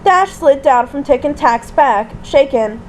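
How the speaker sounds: ordinary delivery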